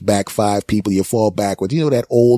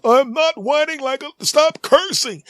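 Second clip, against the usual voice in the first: very high, slow whining protest; whiny old dad; slower than usual. Acted. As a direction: squeal like an old man on the floor.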